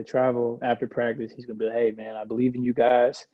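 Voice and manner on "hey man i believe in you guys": ordinary delivery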